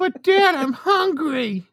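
put-on high, slow whine of a hungry kid; whiny kid. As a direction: beg dad like a little child. Kid voice whining to dad.

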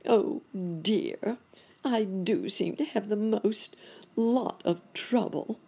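put-on high, woeful old rabbit; woeful cry. A narrator's reading. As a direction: lament your bad luck. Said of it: "cried Uncle Wiggily".

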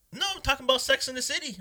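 very high imitation of a woman; lady customer. Lady correcting the cook, high put-on voice.

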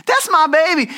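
high, loud proud mama cheer; hammed-up mama bragging. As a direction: shout it like a proud mom at graduation. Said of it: "I love when moms do this".

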